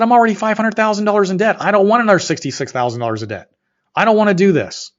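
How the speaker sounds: loud, high-pitched panicked protest; freaking out. Retold or imitated. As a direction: shout it in desperation, reliving the argument. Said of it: "And I'm literally freaking out".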